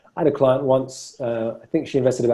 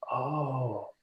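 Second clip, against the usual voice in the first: very soft, drawn-out sigh of realisation; quiet insight; 14 dB quieter than usual. Acted. Coach showing a client's moment of insight, very quiet realisation. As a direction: let it out softly, as if it just dawned. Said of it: "the sound of insight is often quite quiet".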